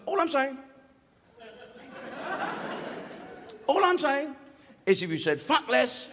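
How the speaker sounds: high, earnest mock boss; high-pitched fussy manager imitation; manager's voice. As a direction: squeak it out like a prim manager. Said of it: "he says like that".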